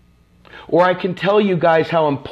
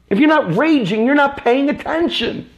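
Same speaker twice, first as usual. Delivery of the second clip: higher, pushed repeat of the line; more intense repeat; 7 semitones higher than usual. Real, not acted. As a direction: hit it harder the second time. Same words repeated, more intense.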